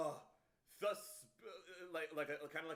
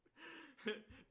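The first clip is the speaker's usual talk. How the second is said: high breathy burst of laughing; a quick crack-up; real laugh; short laugh; 11 semitones higher, 4 dB quieter than usual. Real, not acted. Laughs at clip.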